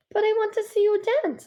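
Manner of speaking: high-pitched insistent plea; childlike pleading. Acted. Girl begging her father to dance, high pleading. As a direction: beg like a child, pitched well up.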